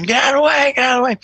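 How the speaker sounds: loud, high yell to clear a path; screaming. Retold or imitated. Cop yelling at the crowd to clear a path, loud. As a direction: bark at the crowd to move. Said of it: "I'm screaming".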